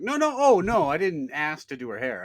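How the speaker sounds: loud, high mock protest; hammed-up protest, starting high and loud; flustered denial. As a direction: blurt it out as a hammed-up backpedal. Mimics the hayride man protesting.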